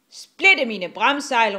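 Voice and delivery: loud, slow pirate oath; captain's shout. A narrator's reading. Rasmus sets off after the dragon, shouting. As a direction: bellow it to the crew. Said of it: "råbte Rasmus".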